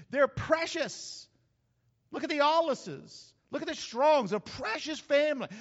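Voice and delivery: high, loud, stretched preacher's cry; full-force preaching. Acted. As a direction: preach it out to the whole church.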